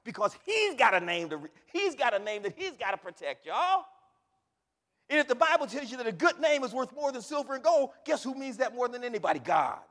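loud, insistent pulpit delivery; full-force preaching. Real, not acted. Preacher telling congregation God protects his name, full force. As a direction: drive the point home loudly.

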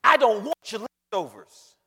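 loud, stretched-out refusal; emphatic divine rebuke. Acted. Preacher as God refusing leftovers, loud rebuke. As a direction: boom it out slowly, word by word.

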